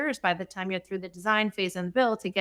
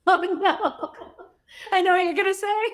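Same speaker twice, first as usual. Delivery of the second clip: laughing, higher and louder; a caught-out laugh, amused and embarrassed; 10 semitones higher, 5 dB louder than usual. Real, not acted. Guest realizes the host found her hidden talent, laughing.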